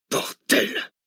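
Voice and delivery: a sharp, high swearing outburst; angry curse. A narrator's reading. Cortès swears at the bad news, angry curse. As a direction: spit out the curse. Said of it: "jura Cortès".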